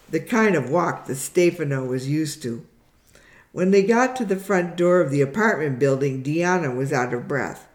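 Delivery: usual speaking voice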